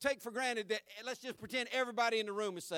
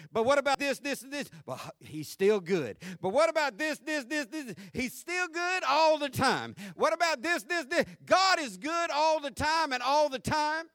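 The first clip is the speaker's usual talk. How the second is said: climbing louder and higher with each answer, full preaching force; building preaching crescendo; 5 semitones higher, 7 dB louder than usual. Acted. Preacher answering the doubts to the church, rising to full force. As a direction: swell from challenge to triumphant shout.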